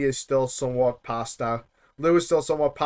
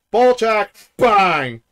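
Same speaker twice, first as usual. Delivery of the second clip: loud, higher gleeful shout of ball check; mock shout; 7 semitones higher, 9 dB louder, much slower than usual. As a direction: bellow it like a gleeful brute. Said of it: "Tiny to just go".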